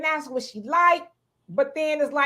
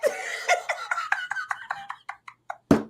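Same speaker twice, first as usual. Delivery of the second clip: very high squeal of laughter; shrieking laugh; 5 dB quieter than usual. Real, not acted. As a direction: break into a shrill shriek.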